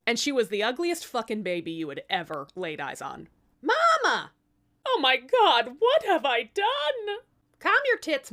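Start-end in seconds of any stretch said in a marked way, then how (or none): 3.6-4.3 very high, loud squeal of Mama; baby calling out. Acted. Newborn calling her mother. 4.9-7.2 high, loud, slow dismay; queen reacting to her ugly baby, high hammed-up horror; horrified wail. 7.6-8.3 high, loud snappy sass; baby telling the queen to calm down, sassy; sassy retort. Acted.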